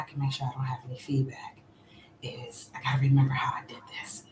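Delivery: breathy whisper while fixing the mic; hushed so the mic won't echo; half-whispered aside. Real, not acted. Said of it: "my bad if I'm whispering".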